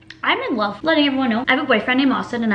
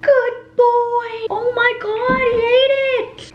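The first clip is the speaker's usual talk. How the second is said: delighted pet praise; 12 semitones higher, much slower than usual. Real, not acted. Owner thrilled her dog ate the cantaloupe, squealing praise. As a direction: gush over the dog like a proud owner. Dog finally eats fruit.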